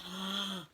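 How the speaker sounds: soft startled intake of breath; gasp. A narrator's reading. Owl peeps through the peephole, startled gasp. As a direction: gasp at what is seen.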